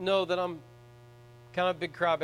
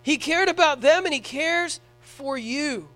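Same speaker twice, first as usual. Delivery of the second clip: louder, higher preaching at full force, with slow emphatic peaks; fervent preaching; 5 semitones higher, 6 dB louder than usual. Acted. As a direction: preach it hard to the congregation.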